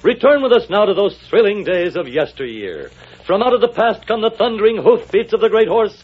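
ordinary talk

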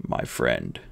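a strong man's last weak words; comforting the boy while dying; dying bodyguard. Acted.

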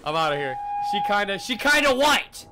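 bolting in fright; scared horror game streamer. Real, not acted. Fearing a jump scare.

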